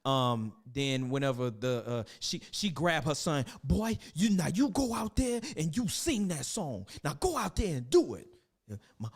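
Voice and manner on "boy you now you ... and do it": bossy mama. Acted. Mama ordering son to sing.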